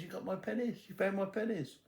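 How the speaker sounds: put-on high-pitched, eager old woman; imitated old lady's high question; excited old lady. Old lady voiced, finding her pennies.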